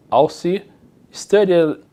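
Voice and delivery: very slow, careful English; slow model sentence. Shown as a demonstration. Teacher reads example sentence slowly to class. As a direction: read it slowly for learners. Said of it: "Alci estudou matemática".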